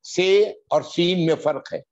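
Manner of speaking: ordinary delivery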